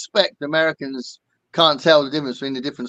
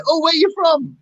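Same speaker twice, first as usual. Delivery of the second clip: high, gushing mock excitement; imitated delighted astonishment; 13 semitones higher, 3 dB louder than usual. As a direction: imitate thrilled strangers, pitched way up. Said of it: "their eyes lit up and go".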